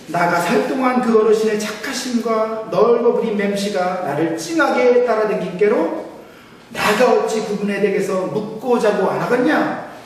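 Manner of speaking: Jeolla dialect. Pretend. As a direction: play a folksy Jeolla elder reciting the psalm. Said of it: "먼저 전라도 버전입니다". (신나게 과장한 전라도 사투리)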